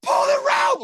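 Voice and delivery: very high, loud strained scream; desperate shout. Retold or imitated. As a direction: yell it before you go back under. Retold cry before going under.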